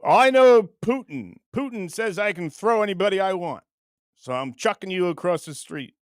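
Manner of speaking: bad Russian accent, loud opening; mock boast in a rough Russian accent; horrible Russian accent. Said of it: "That's a horrible Russian accent".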